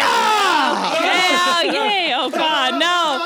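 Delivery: loud drawn-out cheer; excited shout. Real, not acted. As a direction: whoop with excitement. Cheering arrival, energy up.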